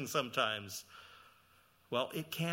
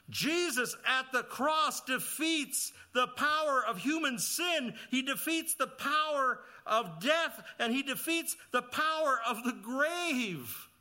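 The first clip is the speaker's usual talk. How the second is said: higher and louder, slow, driving each clause; preaching at full force; 8 semitones higher, 5 dB louder, slower than usual. Acted. Preacher proclaiming Christ's victory to the congregation, full force. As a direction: proclaim it like a victory cry from the pulpit.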